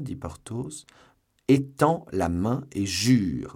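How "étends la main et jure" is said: loud, slow, emphatic order; forceful, drawn-out injunction; crying out. A narrator's reading. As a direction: command him to swear, stretching the words. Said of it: "s'écrièrent à la fois Athos et Aramis".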